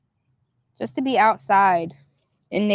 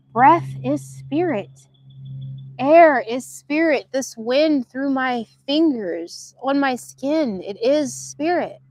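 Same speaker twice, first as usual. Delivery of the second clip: higher, slow reverent delivery; spiritual intoning; 5 semitones higher, slower than usual. Acted. Reflecting to viewers on breath as spirit, slow and reverent.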